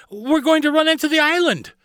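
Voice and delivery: put-on high, loud child's alarm; alarmed exclamation. A narrator's reading. Carly sees the island ahead, crying out in alarm. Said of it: "Carly exclaimed".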